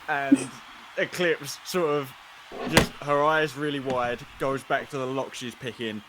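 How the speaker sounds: usual speaking voice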